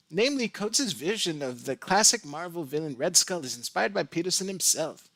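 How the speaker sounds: put-on high, bouncy Valley Girl read. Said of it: "I'm gonna read it like a Valley Girl".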